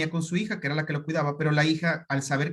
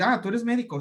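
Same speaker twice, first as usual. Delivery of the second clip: high, sneering mock question; condescending; 6 semitones higher than usual. A specialist belittling a fellow doctor, condescending. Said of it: "hablándote de manera despectiva".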